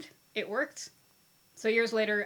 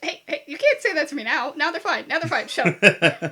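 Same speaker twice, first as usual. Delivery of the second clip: loud, laughing mock outrage; laughing protest; 5 semitones higher, 10 dB louder than usual. Real, not acted. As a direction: protest loudly through a laugh.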